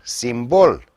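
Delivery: ordinary talk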